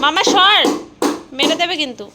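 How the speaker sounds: loud high scolding; shouting. Real, not acted. Scolding child away.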